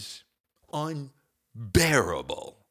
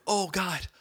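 pained groan; 9 semitones higher than usual. Retold or imitated. Groaning in pain.

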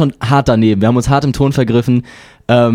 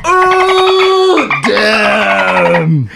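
shocked outburst; 10 semitones higher than usual. Pretend. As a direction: gasp out a shocked crowd reaction. Mock audience reaction to joke.

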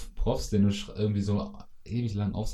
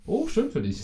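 played-up fake joy; 13 semitones higher than usual. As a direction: stretch it high and syrupy, clearly fake. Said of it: "dieses gespielte".

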